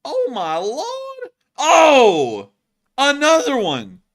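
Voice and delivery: high, loud amazed cry; excited gasp. Real, not acted. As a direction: shout it out in awe. Another hit on screen.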